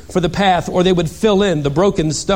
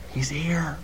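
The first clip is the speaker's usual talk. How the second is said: hushed whisper of awe; 9 dB quieter than usual. Shown as a demonstration. Telling the congregation of Jesus' arrival, whispered. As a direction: breathe it like a secret.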